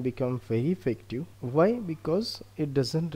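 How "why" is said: usual speaking voice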